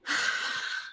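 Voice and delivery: long, breathy exhale; captain's sigh. Acted. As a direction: let out an audible weary sigh. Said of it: "so you hear him".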